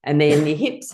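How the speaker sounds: ordinary talk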